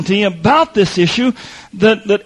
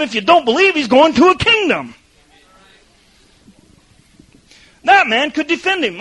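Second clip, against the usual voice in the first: high, driving preacher's cry; impassioned preaching; 6 semitones higher than usual. Acted. Preacher pressing the thief's faith on the church, impassioned. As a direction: lift the congregation with it.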